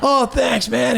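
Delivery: high-pitched mock thanks; grateful stranger. Stranger thanks him for the warning, mock grateful.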